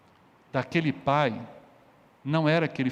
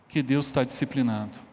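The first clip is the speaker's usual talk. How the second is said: ordinary talk, nothing marked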